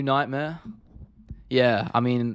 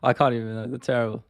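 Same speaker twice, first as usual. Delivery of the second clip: normal talking voice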